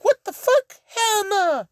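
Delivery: high, loud shocked cry; a shrill cry of disbelief; shocked outburst. Acted. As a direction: squeal out in disbelief. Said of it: "Todoroki's just like".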